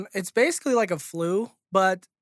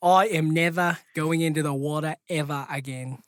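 put-on Australian accent; 2 semitones lower than usual. Clowning for the co-hosts, Australian accent. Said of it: "He was doing Australian".

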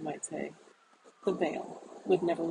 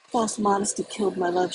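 a louder anguished cry, not a full scream; cried out; 7 dB louder than usual. A narrator's reading. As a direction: cry the words out loud in grief. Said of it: "she cried".

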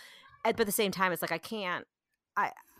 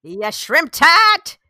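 loud, drawn-out mock Boston insult; Boston accent; 7 semitones higher, 16 dB louder, much slower than usual. Friends joke about mom scolding, loud Boston accent. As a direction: holler it slowly in broad Boston. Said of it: "so much better with a Boston accent".

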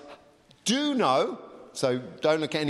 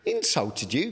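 ordinary delivery

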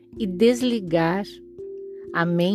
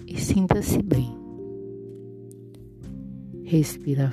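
soft spa whisper; 4 dB quieter, slower than usual. Acted. Guided breathing meditation.

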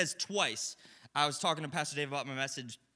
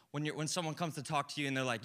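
normal talking voice

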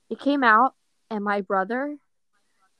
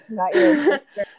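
laughing; 5 semitones higher, 7 dB louder than usual. Real, not acted. Teasing laugh about cousin's age.

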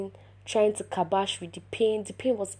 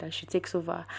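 ordinary talk, nothing marked

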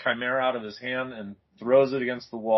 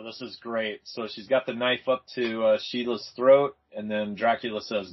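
ordinary talk

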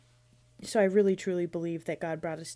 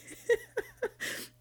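high laugh; 3 dB quieter than usual. Real, not acted. Laughs at her own remark.

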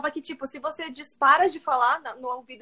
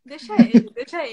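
loud offhand dismissal; careless mother; 2 semitones lower, 9 dB louder than usual. Retold or imitated. Mother brushing off the principal, loud and offhand. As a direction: wave it away.

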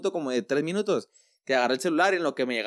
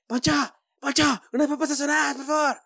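much higher, sing-song calling out Poncho; coworker calling out; 12 semitones higher than usual. Retold or imitated. Coworker nagging the cook for fries, higher mock call. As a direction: mimic her nagging call across the kitchen. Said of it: "Así. / me cagaba que me gritara".

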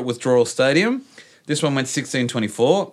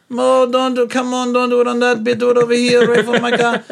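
high, louder polite pleading, half laughing; pleading protest; 12 semitones higher, 6 dB louder than usual. Retold or imitated. Friend pleads with the drunk to pee elsewhere, high, loud and polite. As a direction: beg him to move it away, without swearing. Friend begs man away from car.

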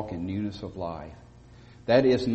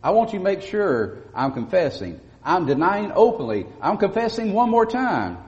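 higher, louder insistent denial as John; emphatic denial; 8 semitones higher, 4 dB louder than usual. Acted. John insisting to the priests he is not him, loud and high. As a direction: plead it firmly, leave no doubt. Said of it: "John is emphatically saying".

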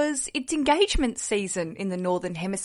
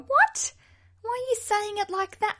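high-pitched, indignant disbelief, much higher and louder; shocked squeal; 13 semitones higher, 4 dB louder than usual. Pretend. Bride reacting to a strange family moment, shocked high squeal. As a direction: squeak out your outrage at a baffling relative.

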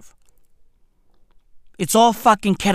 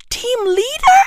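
high, rising disbelief; high-pitched, comically scandalised query; incredulous echo; 12 semitones higher than usual. Acted. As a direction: throw it back as an appalled question. Appalled echo of the wife's answer.

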